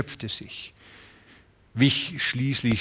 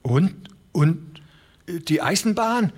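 put-on high, halting old man's voice; old man stammering; 6 semitones higher than usual. Old Broderson stammering to the ranchers in alarm. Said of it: "stammelte der alte Broderson".

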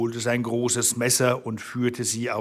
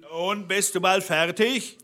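impatient call, pitched higher; calling up from below; 7 semitones higher than usual. A narrator's reading. Bluebeard calling up to his wife, impatient. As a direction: call up with a rising question. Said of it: "Da rief der Blaubart unten".

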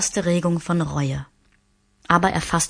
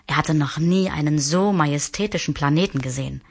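plain reading voice

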